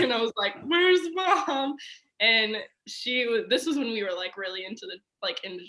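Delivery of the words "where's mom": high, pleading, tearful repeat; crying again. Retold or imitated. Repeating it to her worried stepdad, high and tearful. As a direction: ask for mom once more through tears.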